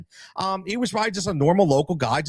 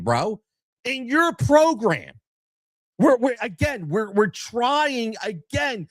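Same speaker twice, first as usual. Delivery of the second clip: heated retort; 6 semitones higher, slower than usual. Retold or imitated. Angry retort to the board.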